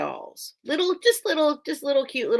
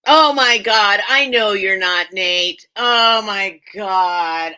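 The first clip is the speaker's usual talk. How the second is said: loud, appalled outburst; real horrified exclamation, loud then stretched out; mortified realization; 9 dB louder, slower than usual. Real, not acted. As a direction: cry out as the blunder hits you. Sudden realization of an embarrassing mistake.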